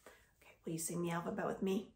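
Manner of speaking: quick breathy whisper. Real, not acted. Teacher whispering an invite to sing along. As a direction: hush it like a secret invitation.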